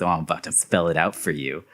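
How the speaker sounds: understated mutter; mild mutter. Retold or imitated. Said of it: "Deadpool mutters to himself".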